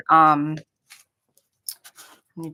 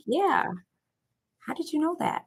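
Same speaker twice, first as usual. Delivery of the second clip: higher-pitched puzzled question, re-enacted; mock surprise, pitched up; surprised reply; 9 semitones higher, 4 dB quieter than usual. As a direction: play back being caught off guard. Acting out her surprised reply.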